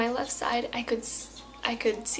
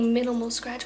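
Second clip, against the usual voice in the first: ordinary talk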